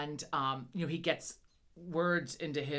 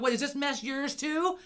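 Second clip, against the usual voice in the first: retold indignant challenge; 8 semitones higher than usual.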